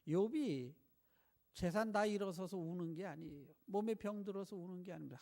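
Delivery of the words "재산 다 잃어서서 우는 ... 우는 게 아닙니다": normal talking voice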